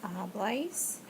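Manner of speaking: Habláis with the accent on the A; stress on the A. Shown as a demonstration. Teacher showing students the verb form, Habláis stressed on the A. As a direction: lean on the A in Habláis. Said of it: "Accent on the A".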